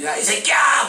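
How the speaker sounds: loud, high retold curse; angry yell. Man on the bus yells at the pastor, loud curse.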